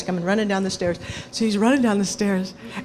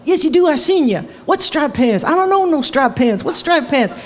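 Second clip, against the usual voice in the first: louder and higher back-and-forth; hammed-up squabble; mock bickering; 5 semitones higher, 4 dB louder than usual. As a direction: argue like two stubborn old men. Acted bickering of two old men.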